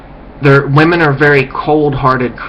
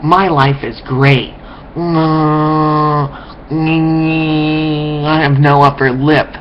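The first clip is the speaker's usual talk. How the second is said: dopey droning voice with long hummed moans; mock brain-dead zombie; much slower than usual. Speaker mocking ignorant people as humming, brain-dead zombies. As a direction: drone it out like a mindless zombie. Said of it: "That's a good look right there".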